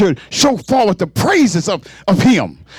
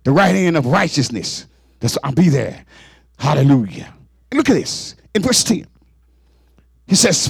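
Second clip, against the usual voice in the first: ordinary delivery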